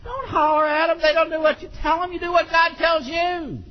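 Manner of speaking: high, sustained preacher's exhortation; forceful preaching. Real, not acted. Preacher admonishing parents not to yell, forceful. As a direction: drive each admonition home from the pulpit.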